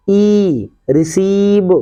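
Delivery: "रिसीव" with a long, drawn-out ee in the second syllable; long ee sound. Shown as a demonstration. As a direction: stretch the ई in "रिसीव". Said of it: "उसका उच्चारण हमेशा बड़ी ई होता है". ("रिसीव" में लंबी बड़ी ई)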